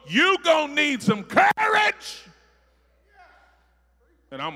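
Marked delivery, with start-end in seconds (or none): 0.1-2.2 loud, higher cry of courage; full-force preacher shout; preaching shout. Real, not acted. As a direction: preach it out to the crowd.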